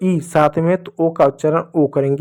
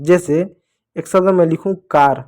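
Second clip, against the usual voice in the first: ordinary talk, nothing marked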